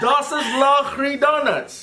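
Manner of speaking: slow, laughing past a mouthguard; mouth guard in. Real, not acted. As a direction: lips stretched wide, giggling.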